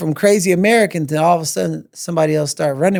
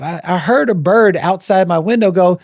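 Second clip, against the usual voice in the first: ordinary talk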